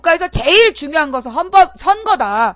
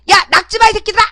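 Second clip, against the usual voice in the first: higher and louder, cursing at the agents; mock yell at agents; 6 semitones higher, 5 dB louder, faster than usual. Host reenacting her yell at the agents, loud mock shout. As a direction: bark it at them like a scolding. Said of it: "이러면서".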